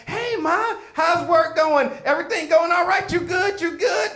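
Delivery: butter up mom before lying; mock innocent. Boy to his mother on the phone, hammed-up innocent high voice.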